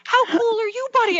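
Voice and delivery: put-on high, bright praise for a child; excited parent praising her son; delighted praise. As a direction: gush over the kid, pitched well up. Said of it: "my husband and I were like".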